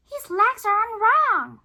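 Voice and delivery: high, loud little skeleton voice; squeaky little skeleton complaining; Little's voice. Acted. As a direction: play the small skeleton. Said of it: "says Little".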